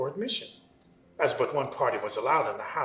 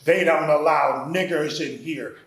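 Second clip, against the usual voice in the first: barked out; 9 dB louder than usual. Retold or imitated. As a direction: bark it at the door like a guard dog. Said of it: "to bark out at my approach".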